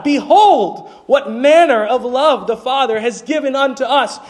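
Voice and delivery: rising, fervent preaching high in the voice; exultant preacher's call. Acted. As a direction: lift them up with joy.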